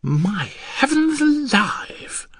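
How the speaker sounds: high, slow exclamation; astonished cry. Acted. Husband grasping the neighbours' trick, astonished. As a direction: exclaim in drawn-out amazement. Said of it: "I cried".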